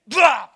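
high, loud mock raspberry of dismissal; mocking blah. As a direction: blurt a bratty blah. Daughter brushing dad off.

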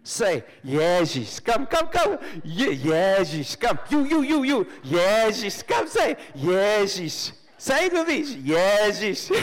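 loud, high-pitched calls to join in; mock rallying shouts. Preacher acts out coaxing people to say the name, rallying shouts. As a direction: beckon and urge them loudly to repeat it.

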